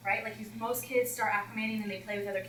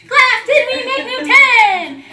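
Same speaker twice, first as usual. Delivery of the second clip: loud laughing shout; 23 dB louder, much slower than usual. Retold or imitated. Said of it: "the same thing all over again".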